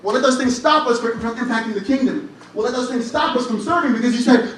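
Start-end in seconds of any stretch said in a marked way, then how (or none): none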